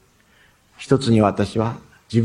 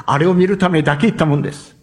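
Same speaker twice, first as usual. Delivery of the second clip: normal talking voice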